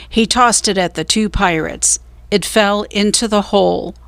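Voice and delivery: ordinary delivery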